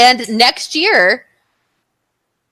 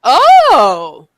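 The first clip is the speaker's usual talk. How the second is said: mock-impressed oh, louder; 5 dB louder than usual. Retelling how the promo staff reacted, mock impressed. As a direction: imitate the staff going oh, oh. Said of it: "They were like".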